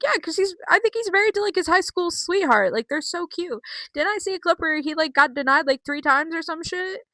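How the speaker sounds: high, excited gossip voice; high excited fan gushing. Real, not acted. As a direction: gush about the cute couple way up high. Gushing about married couple.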